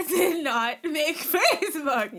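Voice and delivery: laughing through the question, slow and loud. Real, not acted. Podcast host laughing as she asks about Facebook. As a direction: giggle while asking it.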